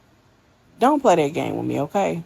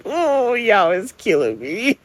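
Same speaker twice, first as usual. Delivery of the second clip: cracking up; 3 semitones higher, 4 dB louder, slower than usual. Real, not acted. Laughing at the clip's filter.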